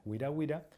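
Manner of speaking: "guira guira" as GWEE-ra GWEE-ra; Latin sounded U. Shown as a demonstration. Teacher correcting to the right name, w-glide in "guira". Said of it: "es guira".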